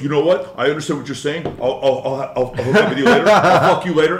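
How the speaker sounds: loud laughing mock bro voice; cocky imitation. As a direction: ham up a smug player. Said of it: "he goes".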